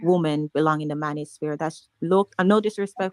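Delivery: loud, insistent objection; forceful talking over. Real, not acted. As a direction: hold the floor loudly over an interruption. Talking over an interrupting speaker.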